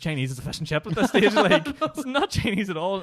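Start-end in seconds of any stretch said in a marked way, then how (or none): 1.3-3.0 laughing. Real, not acted. Laughing at own joke.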